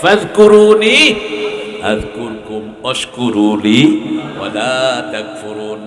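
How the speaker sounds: slow chanted Arabic recitation; slow melodic recitation; Quran recitation. Acted. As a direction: draw out each word in chant.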